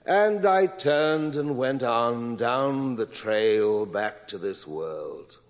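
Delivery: a heightened, unhurried reading of a prose passage; literary reading aloud. Acted. Presenter reading a Kerouac passage to his audience, heightened literary reading. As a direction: read it like poetry, savoring each image.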